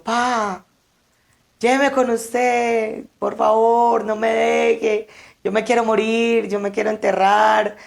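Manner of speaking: high, pleading cry, imitating the grieving son; retold desperate wailing. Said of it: "gritaba muy duro".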